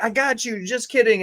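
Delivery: a hint of playful trickster; teasing friend, lightly voiced; mock Wesley. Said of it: "Wesley was like".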